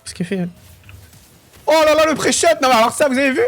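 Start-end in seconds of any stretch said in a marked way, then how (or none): 1.7-3.4 high, loud burst of excitement; amazed shout. Real, not acted. Streamer showing viewers a great pre-shot, thrilled shouting. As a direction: yell it out, voice leaping up.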